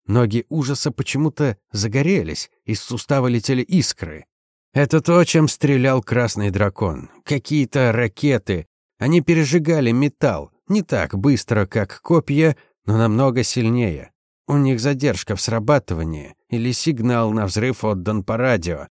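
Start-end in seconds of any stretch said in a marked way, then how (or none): none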